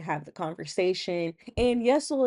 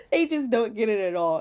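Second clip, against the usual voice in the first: ordinary delivery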